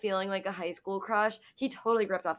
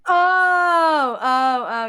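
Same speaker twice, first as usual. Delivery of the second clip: excited outburst; 8 semitones higher, 12 dB louder, much slower than usual. Real, not acted. Reacts to a whispered secret take.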